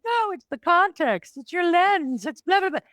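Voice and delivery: high-pitched, mock-naive optimist; singsong high parody of positivity; Pollyanna. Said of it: "I'm like Miss Pollyanna possibility".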